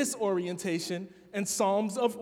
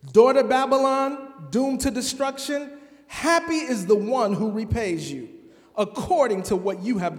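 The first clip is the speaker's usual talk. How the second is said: dramatic scripture reading; 3 semitones higher, 4 dB louder than usual. Acted. Pastor reading Psalm 137 to the congregation, dramatic lament. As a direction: read the psalm like a lament from exile, building to outcry.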